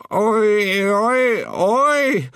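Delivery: rising high, loud yelps; grandmother's shriek. A narrator's reading. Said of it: "Bestemor skrek".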